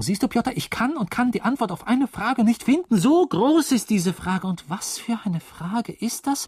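high, swelling, worked-up exclamation; building to a grand loud peak, then easing; agitated declaiming. Acted. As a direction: make the question sound enormous, pitched high. Agitated monologue at his servant.

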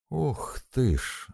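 high, drawn-out gasp of amazement; exclaiming. A narrator's reading. Lika sees the tanker behind them, exclaiming in amazement. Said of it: "воскликнула Лика".

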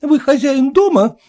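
high, sharp protest; lady shouting back at landlord; indignant shout. A narrator's reading. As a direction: stand up to the landlord. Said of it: "крикнула женщина".

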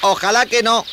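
put-on high, loud boy voice; high-pitched, concerned youngster; hopeful boy. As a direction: answer anxiously, pitched well up. Boy answers spider's worried guess.

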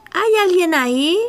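high, loud call into a cave. Acted. Lulú calls into cave.

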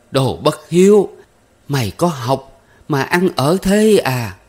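high, loud furious scolding, slowing on the second line; furious mother. Acted. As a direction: rage at the ungrateful son, loud and high. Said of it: "lại nổi giận đùng đùng".

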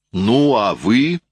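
a son-in-law's indignant, respectful exclamation; angry yet respectful cry. A narrator's reading. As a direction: rebuke him but keep your respect. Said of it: "вскричал Мариус гневно и вместе с тем почтительно".

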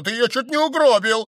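high, loud reproach; angry accusation. A narrator's reading. Stranger blames the count, high loud reproach. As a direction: hurl the blame at him.

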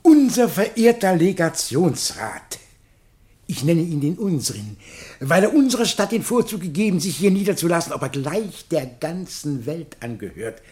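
ceremonious address with long pauses between the titles; pompous public speech; formal speech. Acted. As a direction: address the assembled society solemnly, like a festive orator.

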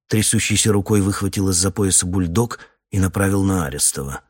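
ordinary delivery

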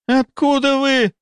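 very high, slow, strangled question; alarmed disbelief. A narrator's reading. Deputy realises the doctor knows too much, high startled voice. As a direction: gasp the question out high and stretched.

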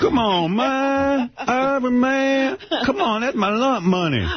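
put-on high whiny street-kid begging; high street pleading. Begging Irvin for his money in a high street voice. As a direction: beg for the lunch money back. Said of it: "redoes his teen street voice".

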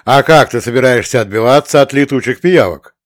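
loud, indignant challenge; mildly outraged commander's question. A narrator's reading. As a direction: demand an answer in protest. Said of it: "возмутился командир".

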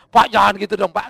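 very loud mock objection; retold protest. Homeowner objecting to the pastor's warning, loud protesting outburst. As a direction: burst out as the alarmed homeowner, imitated.

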